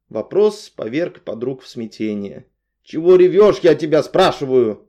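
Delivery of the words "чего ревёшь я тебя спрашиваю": loud, high bark; loud angry demand. A narrator's reading. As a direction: snap at the crying friend. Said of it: "рявкнула Наташка".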